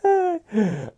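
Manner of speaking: real high shrieking laugh; loud laughing fit. Real, not acted. He loses it at his own line, shrieking with laughter. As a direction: cracks up at his own joke.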